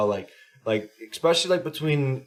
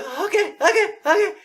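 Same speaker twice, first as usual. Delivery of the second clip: very high, squeaky mock kid; exaggerated high kid voice; imitating clueless kid; 6 dB louder than usual. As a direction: chirp okay like an oblivious little player. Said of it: "And he's like".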